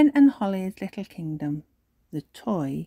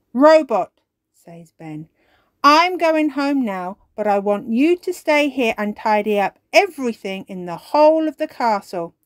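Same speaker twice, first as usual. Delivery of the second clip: commanding; 4 semitones higher, 7 dB louder than usual. Acted. Ben commands robot.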